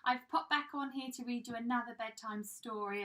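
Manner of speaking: plain reading voice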